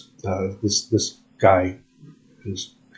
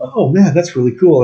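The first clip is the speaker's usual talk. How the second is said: higher and louder, impressed; impressed exclamation; 7 semitones higher, 5 dB louder than usual. Retold or imitated. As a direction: gush like a starstruck fan. Retold impressed reaction.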